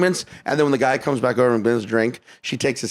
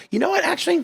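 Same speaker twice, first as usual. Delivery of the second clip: high-pitched mocking imitation of a date; mimicked high, picky interruption; fussy customer; faster than usual. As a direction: chirp it like a customer changing their mind. Date changing her order once more.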